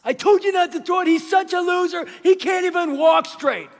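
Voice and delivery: high, shouted taunt; loud, jeering kid outburst; kid going off. Retold or imitated. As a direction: yell it like a playground bully losing it. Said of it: "And Ben went off".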